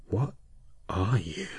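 frightened trembling question. Acted. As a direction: ask it shaking with fear.

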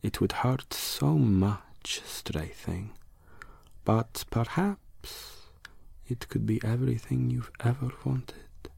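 slow whispered tempting; eerie slow creature whisper; seductive monster. Acted. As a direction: lure her in slowly.

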